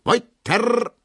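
high, loud call across the hall. A narrator's reading. Dance master calls the couples back onto the floor, loud. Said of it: "ruft der Tanzmeister".

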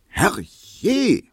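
high, loud yell from indoors; startled shriek. A narrator's reading. Hedwig shouts from inside at the ringing bell, shrieking. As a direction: shriek it out in exasperation. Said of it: "schrie Hedwig drinnen".

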